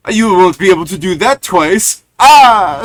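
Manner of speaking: loud, high, furious monster shout; angry vampire. Acted. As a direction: roar the threat at them. Angry vampire turns around.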